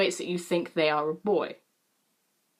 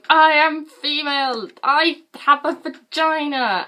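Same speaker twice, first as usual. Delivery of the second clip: high, loud, drawn-out outburst; mock scream; 7 semitones higher, 6 dB louder, slower than usual. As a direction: holler it slowly, hammed-up exasperation. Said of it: "I just wanted to scream at them".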